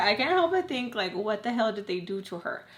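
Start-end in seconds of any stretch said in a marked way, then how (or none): none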